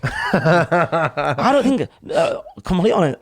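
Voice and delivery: real laughter breaking into the words; laughing. Real, not acted. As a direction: laugh through an awkward denial. Laughing at the awkward question.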